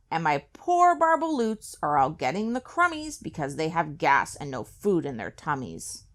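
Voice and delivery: usual speaking voice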